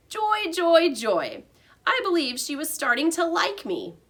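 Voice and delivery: cheeky hamster. A narrator's reading. Hamster Humphrey boasts to his teacher, high and proud. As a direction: puff up and brag, tiny and pleased.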